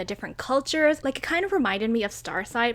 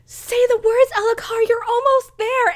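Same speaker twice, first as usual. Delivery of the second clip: urging Kaladin; 13 semitones higher, 6 dB louder than usual. Retold or imitated. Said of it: "Kaladin is just like".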